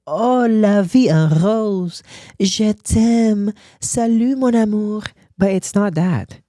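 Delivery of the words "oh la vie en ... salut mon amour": higher, slow, swooning mock-French crooning; dreamy romantic French cliché. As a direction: drawl it like a lovesick Parisian postcard. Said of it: "they're gonna be like".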